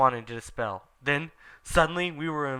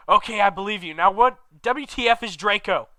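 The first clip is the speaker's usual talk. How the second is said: raised, pushy challenge; exasperated demand; 6 semitones higher, 6 dB louder than usual. Acted. Ebony demanding answers from Hagrid, exasperated. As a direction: fire the question back at him. Narrator voicing Ebony's outburst.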